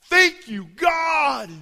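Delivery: high, loud shouted praise; ecstatic thanks. Retold or imitated. Overjoyed leader shouts thanks to God. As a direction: pour out gratitude.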